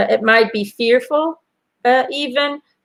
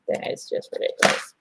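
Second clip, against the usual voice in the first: breathy whispered grumble to herself; whispered aside; 10 dB quieter, faster than usual. Real, not acted. Host fixing her blouse on camera, mutters a whispered aside. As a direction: drop to a private whisper, half to herself.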